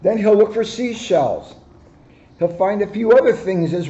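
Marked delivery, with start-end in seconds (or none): none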